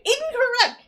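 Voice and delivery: Incorrect called out high and loud; emphatic verdict. Real, not acted. Co-host ranting at the movie's Hot Rod, loud emphatic verdict.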